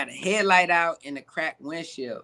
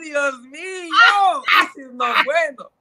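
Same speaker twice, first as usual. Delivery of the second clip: mock dismay; 8 semitones higher, 5 dB louder than usual. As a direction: cry out in hammed-up Spanish alarm. Acted dismay at the arrest.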